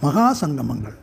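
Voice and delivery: measured poetry reading, pausing after each short phrase; deliberate, declaimed verse with separated phrases; poem recitation. Acted. Poet reads own poem aloud.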